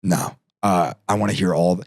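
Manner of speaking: low, quiet, unbudging star refusal; Jack Nicholson. Retold or imitated. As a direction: drop low and soft to refuse. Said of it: "He's like".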